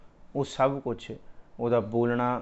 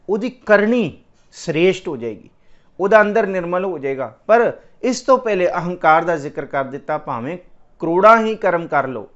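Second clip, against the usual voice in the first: raised, ringing preacher's voice, driving each verse home; fervent preaching; 4 semitones higher, 6 dB louder than usual. Acted. Preacher to the congregation on ego, fervent preaching. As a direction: preach it out to the whole hall with full force. Katha preaching at full force.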